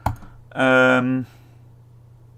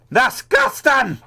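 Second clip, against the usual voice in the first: high, slow, loud mock-accent "Disgusting"; exaggerated accent echo; 13 semitones higher, 5 dB louder, much slower than usual. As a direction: stretch "Disgusting" out in a put-on accent. Echoing it more exaggeratedly.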